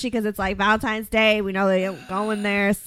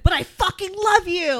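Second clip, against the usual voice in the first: much higher, slow drawn-out declaration; hammed-up dramatic climax to a rant; mock passionate outburst; 9 semitones higher than usual. Shown as a demonstration. Friend finishing the fake breakup rant, mock passionate outburst. Caps the demonstrated yelling rant.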